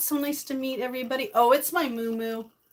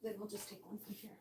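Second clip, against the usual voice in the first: much softer, muttered to herself; muttered aside; 2 semitones lower, 21 dB quieter, faster than usual. Real, not acted. Cook talking to herself over the plates, muttered. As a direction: mumble it under your breath.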